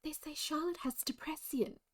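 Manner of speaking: high whispered gossip; hushed posh mum gossip. Retold or imitated. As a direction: breathe it like a scandalised posh mother. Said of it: "she's like".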